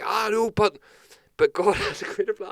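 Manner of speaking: put-on high, loud pious drunk; drunk believer. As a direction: pitch it up like a tipsy believer sure of himself. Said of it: "he's like".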